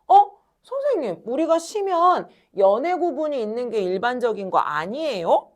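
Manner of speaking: mock student. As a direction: pipe up like a puzzled pupil. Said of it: "라고 생각할 수 있잖아".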